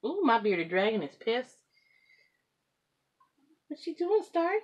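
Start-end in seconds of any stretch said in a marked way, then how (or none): 3.7-4.5 high, loud call to a pet; sing-song call to bring the cat over. Real, not acted. Calling the cat again.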